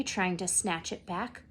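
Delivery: usual speaking voice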